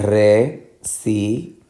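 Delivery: residencia split as re, si, den, cia; divided into syllables. Shown as a demonstration. Teacher dividing a longer word, residencia syllable by syllable. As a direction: pause between each syllable of residencia. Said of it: "tú la divides".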